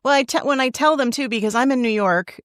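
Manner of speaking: normal talking voice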